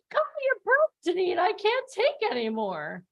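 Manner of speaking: high, hammed-up plea that slows at the end; mock pleading microphone. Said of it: "her microphone was screaming".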